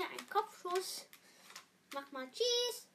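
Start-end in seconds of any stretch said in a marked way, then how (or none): none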